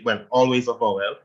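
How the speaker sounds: normal talking voice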